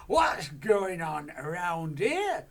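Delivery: a giantess demanding breakfast; shouted. Acted. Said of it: "she shouted".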